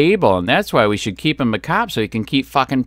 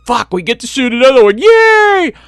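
high, gleeful mock cop cheer, much higher and louder; mock trigger-happy cops; 11 semitones higher, 5 dB louder than usual. Mocking cops who would have shot them, gleeful high squeal. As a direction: squeal it like a cop thrilled to shoot. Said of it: "They would have said".

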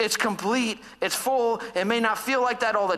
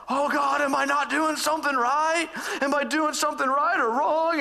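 higher, anxious retold plea to God; retold worry; 7 semitones higher than usual. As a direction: replay the panicked prayer, a touch hammed up. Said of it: "so worried I'm just gonna miss it".

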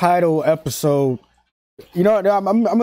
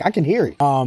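normal talking voice

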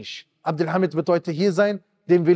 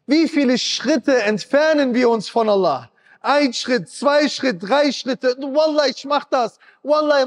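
hoch und eindringlich predigen, Stimme steigert sich; Predigt mit voller Kraft; 7 semitones higher than usual. Acted. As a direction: die Gemeinde aufrütteln, flehend und hoch. Preaches with rising intensity. (leidenschaftliche Predigt, hoch und drängend)

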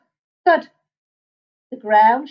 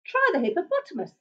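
quick bird voice; 7 semitones higher, 6 dB quieter, faster than usual. Acted. Another bird suggesting.

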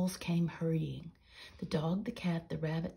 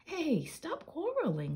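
laughing; 10 semitones higher than usual. Acted. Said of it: "laughed Peter Painter".

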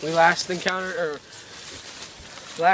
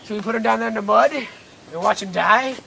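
ordinary talk

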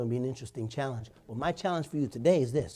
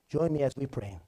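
ordinary talk, nothing marked

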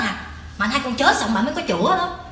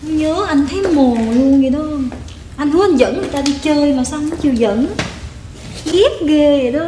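coy sweet talk. Acted. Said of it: "Mày đổi tông lẹ quá".

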